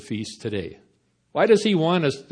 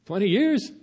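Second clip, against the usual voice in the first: higher, rising disbelief; as if he can't believe the offer; the second take jumps up in astonishment; incredulous; 8 semitones higher than usual. Acted. Human repeating God's offer in disbelief.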